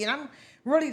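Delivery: normal talking voice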